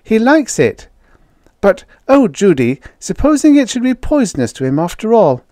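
put-on high, loud girlish voice; excitement tipping into worry; excited, worried girl. A narrator's reading. Jane to Judy as the alien eats, excited then anxious.

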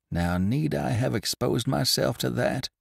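snubbed man grumbling to himself. Acted. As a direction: mutter your wounded pride. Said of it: "grumbled the unhappy man".

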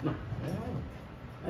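talking with a mouth full; words round a bite of food. Real, not acted. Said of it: "Ainda fala com a boca cheia ainda".

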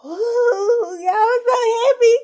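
overflowing with grateful tears; happy tears. Real, not acted. Fan telling viewers he's happy the actor is back, crying. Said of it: "ooh, it makes me emotional".

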